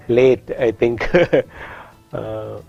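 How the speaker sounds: ordinary delivery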